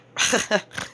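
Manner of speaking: high, short burst of laughter; short chuckle. Real, not acted. Cook joking to viewers about burning them, short chuckle. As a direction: laugh briefly at your own remark. Laughs at her own remark.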